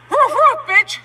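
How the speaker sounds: high-pitched taunting woofs; jeering imitation of a dog; mocking dog bark. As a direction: bark at him in mockery. Mocking him with dog barks.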